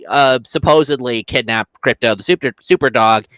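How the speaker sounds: ordinary delivery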